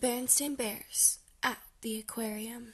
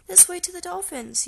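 understated yell, pitched up high; mild high yell; 7 semitones higher than usual. A narrator's reading. Sister spots the sign to the dolphins, mildly yelling up high. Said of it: "yelled Sister".

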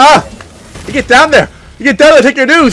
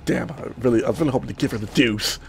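low, very soft disappointment; quiet, let-down aside; deflated; 11 semitones lower, 14 dB quieter, faster than usual. Real, not acted. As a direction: mumble it to yourself after a letdown. Deflated after the fight ends.

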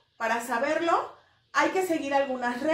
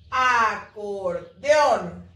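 "Acordeón" very slow and lower, A-cor-deÓN; syllable by syllable; 6 semitones lower, 3 dB louder, much slower than usual. Shown as a demonstration. Teacher reading back the accented word, syllable by syllable. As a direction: draw out each syllable of "Acordeón", strong final ÓN. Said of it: "dividirla en sílabas".

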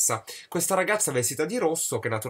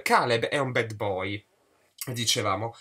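usual speaking voice

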